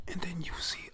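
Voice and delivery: ordinary delivery, nothing marked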